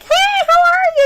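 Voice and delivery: very high, loud squealed hello; gushing greeting. Retold or imitated. Server greets her regular before a hug, high excited squeal. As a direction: squeal a delighted welcome.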